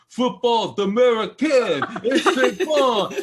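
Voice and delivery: put-on high, loud laughing French announcer with a heavy Brooklyn accent; Brooklyn-accented French TV announcer, hammed-up with laughter. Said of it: "speaking French with a Brooklyn accent".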